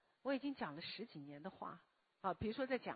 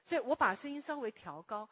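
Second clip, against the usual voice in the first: raised lecture voice; 3 semitones higher, 7 dB louder than usual. Shown as a demonstration.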